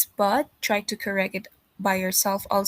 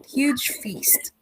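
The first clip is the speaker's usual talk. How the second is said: whispering; 4 semitones higher than usual. Real, not acted. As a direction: whisper the words. Student whispers reading aloud.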